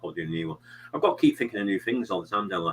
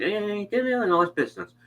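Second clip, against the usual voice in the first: mum shouting; 13 semitones higher than usual. Retold or imitated. Streamer mimics a mum yelling mid-livestream, high mock yell. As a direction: imitate mum calling the kid in, pitched way up. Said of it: "your mum shouting".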